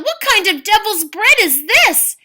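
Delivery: loud, high pained outrage; outraged giant. Acted. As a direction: rage through broken teeth. Giant in pain, angry.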